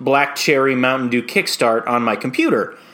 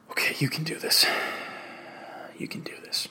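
hushed, under-the-breath self-encouragement; whispered pep talk; 13 dB quieter than usual. Acted. Nervous performer psyching himself up before the audition, whispered pep talk. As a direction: whisper it to yourself.

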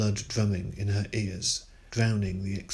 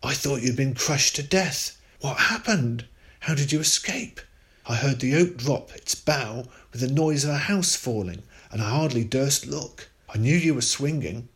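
higher and louder, shaken; a father shaken by fear; a touch of trembling fear, rushed and loud; frightened relief; 5 semitones higher, 4 dB louder than usual. A narrator's reading. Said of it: "trembling with fear".

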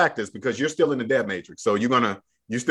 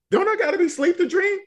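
sudden realization; faster than usual. Retold or imitated. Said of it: "they don't realize".

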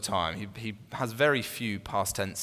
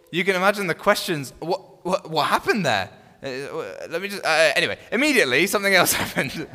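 mock hurried excitement; 7 semitones higher, 8 dB louder than usual. Mocking Mark's rushed narration.

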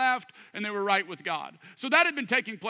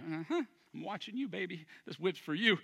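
quiet menace; 3 semitones lower, 6 dB quieter than usual. Retold or imitated. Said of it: "He's just getting mad".

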